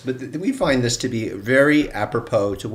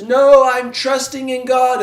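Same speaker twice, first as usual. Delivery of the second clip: stubborn refusal; 12 semitones higher, 9 dB louder, slower than usual. Retold or imitated. Man refuses again.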